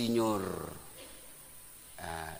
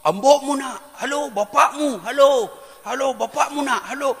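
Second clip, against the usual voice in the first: imitated high-pitched phone shouting; 8 semitones higher, 3 dB louder than usual. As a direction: shout into a phone that isn't connecting. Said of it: "Baru dia teriak". (meniru orang berteriak di telepon, nada tinggi)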